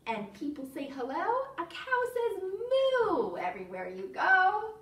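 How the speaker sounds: animated, sing-song picture-book reading; playful storytime narration with stretched key words; reading to children. Acted. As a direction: read it to toddlers with lively, swooping pitch.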